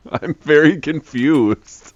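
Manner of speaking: slow, giggling confusion; laughing. Real, not acted. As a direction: laugh through the words.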